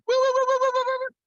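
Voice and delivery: very high repeated woo-woo; imitated whooping. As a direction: hoot a rapid high-pitched sound effect. Imitated sound for the departure.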